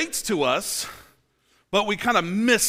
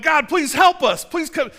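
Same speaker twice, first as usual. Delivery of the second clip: high pleading cry for help; mock desperate plea to God; 8 semitones higher, 6 dB louder than usual. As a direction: beg God to come, voice lifted. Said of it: "You're crying, going".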